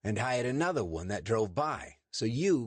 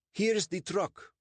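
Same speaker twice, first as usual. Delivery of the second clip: high, slow, eager-to-please voice; nervous Sandeep; 13 semitones higher, 4 dB louder, slower than usual. Acted. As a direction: play the anxious host for the police. Sandeep shows police the truck.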